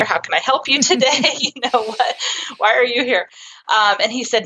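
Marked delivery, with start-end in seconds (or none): 0.0-3.3 high, quick, laughing question; mock-puzzled, breaking into a laugh; amused retort. Therapist to returning sceptical dad, amused retort.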